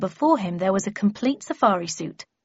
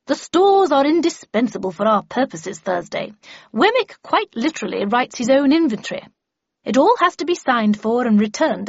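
put-on higher, swooping, declarative old lady; emphatic Miss Havisham; 4 semitones higher, 4 dB louder than usual. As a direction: hold forth grandly to an apprentice.

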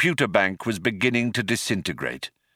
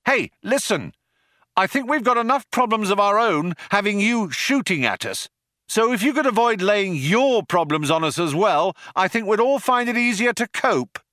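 high, raised voice calling across; shouting out; 12 semitones higher than usual. A narrator's reading. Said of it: "Ford shouted out".

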